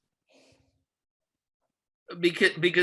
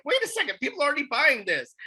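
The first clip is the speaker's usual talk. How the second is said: surprised realization; 10 semitones higher, faster than usual. Retold or imitated. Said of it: "and I'm like".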